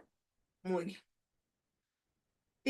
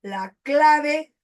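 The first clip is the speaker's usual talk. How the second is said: "La clave" loud, "clave" hit hard; loud emphasis on "La clave"; stressed phrase; 4 semitones higher, 6 dB louder than usual. Shown as a demonstration. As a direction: punch "clave". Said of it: "Qué repite".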